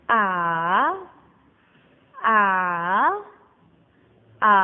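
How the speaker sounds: A curving low, then back up; dipping tone. Shown as a demonstration. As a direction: bend A down and up. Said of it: "Yang ketiga itu nadanya lengkung".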